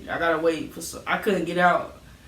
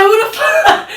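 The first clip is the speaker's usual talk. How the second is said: much higher, loud shout of disbelief; shocked shout; 10 dB louder, slower than usual. Retold or imitated. Kid stunned that mom knew about the party, high shout. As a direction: yell it, caught completely off guard.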